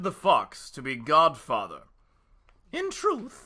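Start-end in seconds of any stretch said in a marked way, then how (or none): 2.7-3.5 high put-on she-wolf voice.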